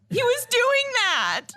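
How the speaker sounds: high, gasping crying breath; imitated sob gasp. Mom imitating her crying son, a high gasp. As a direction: gasp like a sobbing child. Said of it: "you're like".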